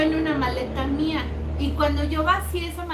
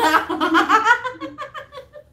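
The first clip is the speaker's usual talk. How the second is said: high laugh, a bit louder; real laugh; 9 semitones higher, 3 dB louder than usual. Real, not acted. Retelling the phone call to her friend, higher laugh. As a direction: break into laughter at the line.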